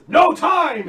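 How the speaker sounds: loud, high guard's shout; urgent guard yelling, high and loud. Acted. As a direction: shout it out in a rush to act. Guard rushing to break in.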